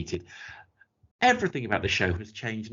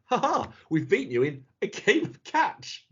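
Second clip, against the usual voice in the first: mock villain taunt; 8 semitones higher than usual. Mocking the Toymaker's taunt.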